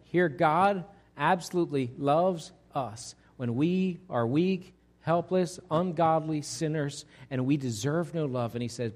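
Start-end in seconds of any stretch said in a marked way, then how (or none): none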